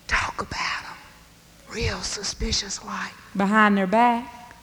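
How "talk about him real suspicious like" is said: whisper. A narrator's reading. Folks gossiping behind backs.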